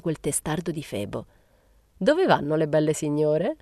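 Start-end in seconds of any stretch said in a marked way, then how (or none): 2.0-3.6 lightly sneering question; mock-gallant, with a hint of a smirk. A narrator's reading. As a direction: tease the ladies with a sly sneer. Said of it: "ghignò".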